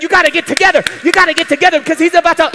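fast, urgent rush; hurried molecules. Pretend. Molecules hurrying to hold Peter up, fast. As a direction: rattle it off in a hurry.

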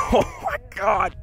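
very high, drawn-out exclamation; awestruck. Real, not acted. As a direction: squeak it out slowly in amazement. Lands another big fish.

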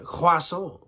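French "croissant" with throaty R; French accent. Shown as a demonstration. Teaching a French accent warm-up, French pronunciation. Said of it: "French now".

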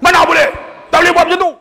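high, loud scolding shout; irate official shouting someone down; angry refusal. Retold or imitated. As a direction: yell the refusal at full pitch. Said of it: "Dia marah pakcik tu".

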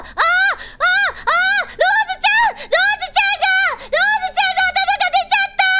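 hammed-up high, wailing scream; 悲鳴まじりの絶叫. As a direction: play a parent shrieking in horror for their child. 娘の瓶が割れた場面を想像. (泣き叫ぶ親を大げさに演じる絶叫)

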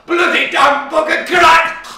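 high, loud cursing yell; furious shout. Acted. Boy screaming curses as he is locked in.

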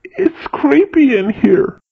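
high, loud, spooked and breathless; panting. A narrator's reading. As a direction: puff it out nervously in a creepy tunnel. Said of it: "panted Windbag".

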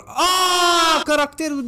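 high, drawn-out exclamation; excited cry. Real, not acted. Player recognizing a racing game character, excited.

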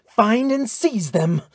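slow, high, forceful order; imperious, drawn-out shout; commanding cry. A narrator's reading. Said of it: "cried Thor's daughter".

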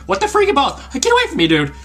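high panicked yell; scared and angry. Real, not acted. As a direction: shriek at the alien to back off. Alien attacks, panicked yelling.

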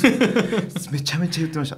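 laughing as she admits it, high and loud. Real, not acted. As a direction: burst out laughing while confirming. Admits parents pushed school. (笑いながら)

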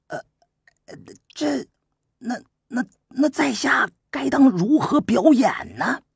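whispering his confusion, afraid to ask; hushed nervous stammer. Acted. The bewildered prince timidly asks Su Qin again, hushed and high.

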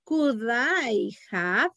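Could I have drawn out slowly; slow model question for students; slow demo phrase. Shown as a demonstration. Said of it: "Esas son las frases".

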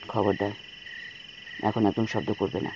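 very low, soft stern hush; whispered fury. Acted. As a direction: scold in a low hushed voice. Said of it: "শাসন করল অভ্র".

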